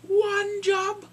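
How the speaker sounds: high, loud comic disbelief; hammed-up scandalized sketch voice; skit impression. Skit parent appalled she's dating a doctor, high comic outrage. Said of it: "there's this skit on In Living Color".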